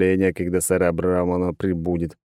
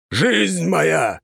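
shouting; 9 semitones higher than usual. Acted. The warrior leads the oath.

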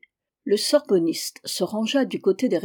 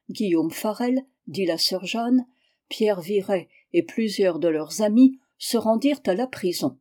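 plain reading voice

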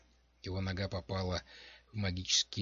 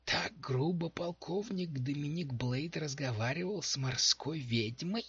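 goblin voice; 6 semitones higher than usual. A narrator's reading. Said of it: "подал голос зелёный гоблин".

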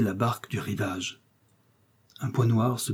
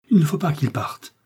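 higher-pitched urgent exclamation; crying out; 5 semitones higher, 3 dB louder than usual. A narrator's reading. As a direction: blurt it out in mild alarm. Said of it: "s'écria Milady".

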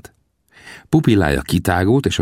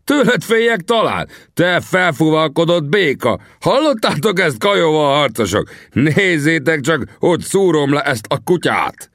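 high, bragging bellow to the whole crowd; sustained high-pitched warrior's rant; roaring taunt; 11 semitones higher than usual. A narrator's reading. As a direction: howl insults at your opponent. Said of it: "üvöltötte".

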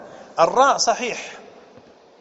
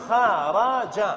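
syllable by syllable; much slower than usual. Shown as a demonstration. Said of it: "لاحظوا جيدًا هنا".

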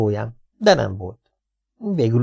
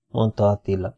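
plain reading voice

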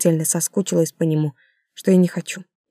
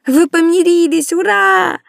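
put-on high, loud child voice; child's joy; 11 semitones higher, 5 dB louder, slower than usual. A narrator's reading. Daughter cheers that her parents made up, high and joyful. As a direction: squeal it like a happy little girl.

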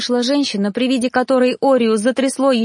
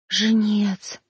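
a softer, scared echo of the word; frightened hush; 3 dB quieter than usual. A narrator's reading. Girl echoing the reaper, scared and hushed. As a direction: breathe the word out in fear. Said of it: "испуганный шёпот".

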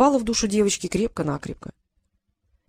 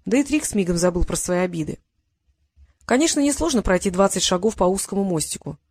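ordinary delivery, nothing marked

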